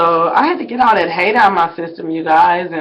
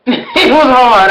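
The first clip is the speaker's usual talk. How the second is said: louder, slow, laughing it out; laughing emphasis; 5 semitones higher, 7 dB louder, slower than usual. Real, not acted. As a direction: lean in with a laugh and stretch it.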